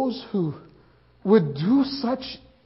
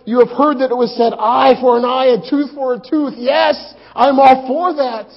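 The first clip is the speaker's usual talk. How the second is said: angry; 5 semitones higher, 9 dB louder than usual. Acted. Angry talk of hitting Romans.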